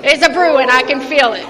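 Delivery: loud, amused, between bursts of laughter; laughing. Real, not acted. Preacher warning of a brewing hot flash, laughing. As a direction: announce it loudly, cracking up.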